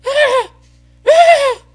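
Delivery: piercing high screams of panic; retold scream. Buddy in a dark canyon screaming at spiders, high panicked shriek. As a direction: shriek like a friend seeing spiders.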